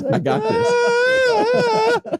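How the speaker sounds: put-on very high, very slow squealing kid; a drawn-out, very high childlike I got this; proud toddler voice. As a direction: squeal it like a tiny kid who did it himself.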